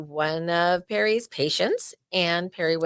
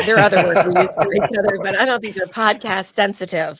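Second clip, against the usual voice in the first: louder, quicker, laughing through the words; 7 dB louder than usual. Real, not acted. Hinting at rude nicknames for each other, laughing. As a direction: laugh as the joke lands. Joking about private nicknames.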